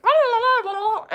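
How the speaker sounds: high, loud dismissive babble; mocking blah blah. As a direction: parody her rambling lecture. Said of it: "that's when she's like".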